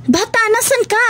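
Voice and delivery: high searching call. Acted. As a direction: call into the dark for the child. Searching for the lost child.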